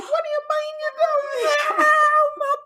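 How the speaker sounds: very high, loud imitation shriek; mock hysterical scream. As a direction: scream it hammed-up, imitating a frantic mother. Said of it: "Terry is screaming about her baby".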